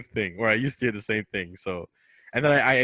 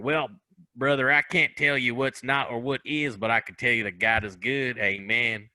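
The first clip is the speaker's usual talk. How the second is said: put-on Southern accent. Imitating a North Carolina man he met, Southern accent. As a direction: answer like a clueless Southern guy. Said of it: "I'm doing the accent".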